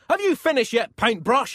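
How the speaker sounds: high, loud impatient shout; angry shout. A narrator's reading. Angry Pope calls up to the painter, shouting. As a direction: shout up to someone on a scaffold. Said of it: "he shouted".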